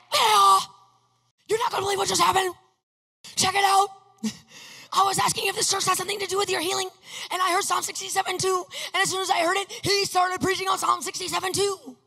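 high, fast excited shouting; loud, high-pitched rush of excitement; freaking out. Retold or imitated. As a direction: burst out with breathless news at top pitch. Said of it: "I'm freaking out".

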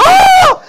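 very high, loud panicked shriek; hammed-up scream of panic; freaked-out yelp. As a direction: let out a sudden terrified cry. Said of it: "he's freaking out".

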